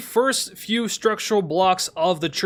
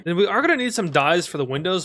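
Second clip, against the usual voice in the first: ordinary talk, nothing marked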